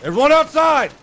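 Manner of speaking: high, loud shouted order to clear out; urgent shout. Acted. Officer yelling at bystanders to get out, loud and urgent. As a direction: bark the evacuation order at full volume.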